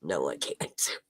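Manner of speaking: drowsy, slurred and low; groggy from anesthesia. Real, not acted. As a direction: talk like you're barely awake after surgery. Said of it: "that's why I'm talking so funny".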